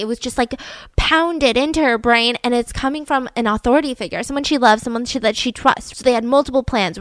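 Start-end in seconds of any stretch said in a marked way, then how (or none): none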